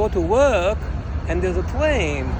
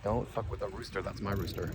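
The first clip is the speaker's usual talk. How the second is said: voicing the dog; 8 semitones lower, 12 dB quieter than usual. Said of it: "The dog's like".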